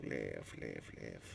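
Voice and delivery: muttering. Acted. God muttering the name.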